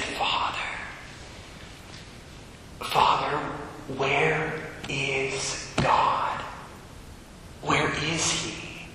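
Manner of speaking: faint whisper, very soft and slow; wisp of a voice. Retold or imitated. Dying woman asking the priest, faint slow whisper. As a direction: barely breathe the words out. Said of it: "this little wisp of a voice".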